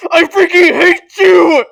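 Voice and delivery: mock kid tantrum. Child let down by parent.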